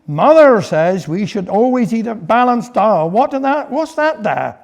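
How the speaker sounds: usual speaking voice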